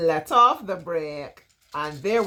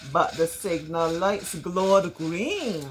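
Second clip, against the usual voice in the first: ordinary delivery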